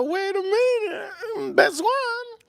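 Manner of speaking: very high incredulous squeal; comic high-pitched disbelief; mock shock. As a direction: act floored by the request, voice shooting up. Retold shock at wine request.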